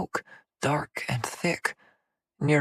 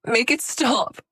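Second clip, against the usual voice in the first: much higher, desperate cry; panting plea; 10 semitones higher, 4 dB louder, slower than usual. Acted. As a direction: beg breathlessly for it to end. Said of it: "she panted".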